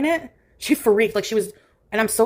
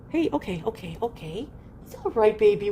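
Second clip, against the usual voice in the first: soft soothing; 5 dB quieter than usual. Real, not acted. Soothing the cat.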